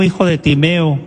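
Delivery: usual speaking voice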